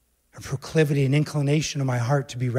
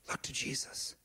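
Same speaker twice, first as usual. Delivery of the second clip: barely audible whisper of Look to Jesus; 19 dB quieter than usual. Real, not acted. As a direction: breathe it like a secret. Hushed repeat of church motto.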